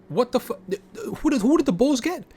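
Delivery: who did they even get, shocked; outraged disbelief. Real, not acted. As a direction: pitch jumps way up in disbelief. Outraged at Bulls trade.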